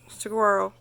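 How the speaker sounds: ordinary delivery, nothing marked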